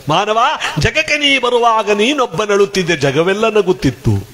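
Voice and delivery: high, declaimed devotional verse; saint's verse recited. Acted. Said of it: "ಶರಣರು ಹೇಳ್ತಾರೆ".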